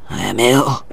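low, pained voice; ailing. A narrator's reading. Father after the fight, feeling ill. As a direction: sound unwell, voice sunk low.

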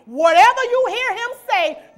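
shouted preacher's call; "Whatever you hear him say" shouted out full; loud command. Acted.

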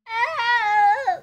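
a sharp, high, loud startled cry; sudden yelp. Real, not acted. Startled when the baby screams, a loud high yelp.

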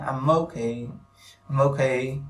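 "I'm okay" joined as "am-mokay"; no gap between "I'm" and "okay", the m starts the second word; linked sounds. Shown as a demonstration. Teacher repeating "I'm okay" for learners, linked sounds. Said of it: "nối âm nhé".

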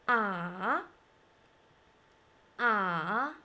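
"Ah" low, falling then rising; long "Ah" sinking low and swinging back up; third tone. Shown as a demonstration. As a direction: dip each "Ah" deep, then lift it. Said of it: "start from a low pitch, then falling, then rising".

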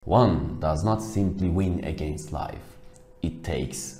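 slow, very low, weighty delivery; mock-solemn movie line. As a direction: draw it out low like a film tough guy.

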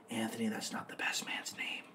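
hushed whisper in his ear. Retold or imitated. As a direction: lean in and whisper the warning. Sister leans over to warn.

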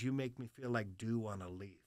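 put-on low, hushed old-timer; old military man. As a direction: imitate an old veteran, softly and low. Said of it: "This old hard, like, military cat".

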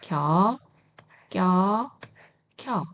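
lax 겨, tense 껴, aspirated 켜; syllable drill. Shown as a demonstration. Teacher showing combined letters to learners, separated 겨, 껴, 켜. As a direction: read 겨, 껴, 켜 apart, each onset distinct. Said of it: "이렇게 읽어주시면 되세요".

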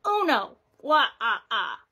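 lightly voiced Count counting; a hint of the Sesame Street Count; Count von Count. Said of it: "the Sesame Street number of the day".

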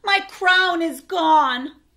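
slow, high and loud lament; dismayed wail. Acted. Babar lamenting his missing crown, slow, high and loud. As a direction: stretch each word out in despair.

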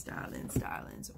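breathy whisper of darlings. Real, not acted. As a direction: drop it to a hush, like a secret. Repeats darlings, drops to whisper.

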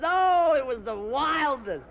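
gushing bride. Retold or imitated.

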